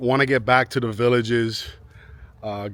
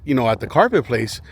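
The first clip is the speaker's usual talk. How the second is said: normal talking voice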